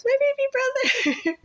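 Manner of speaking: very high drawn-out mock scream; retold screaming. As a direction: squeal it in reenacted panic. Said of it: "I started screaming really loud".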